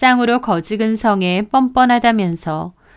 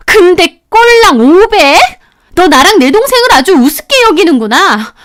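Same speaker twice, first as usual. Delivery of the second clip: angry tirade; 5 semitones higher, 5 dB louder than usual. Acted. Sister-in-law scolding her brother's wife, furious yelling. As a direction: berate her like a furious elder.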